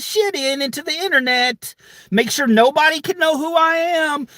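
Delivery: high, whiny mocking voice of an anonymous troll. Mimicking anonymous racist troll.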